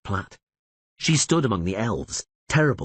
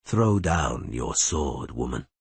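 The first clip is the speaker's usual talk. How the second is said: calm demand; 6 semitones lower, slower than usual. A narrator's reading. Elf calmly demanding surrender.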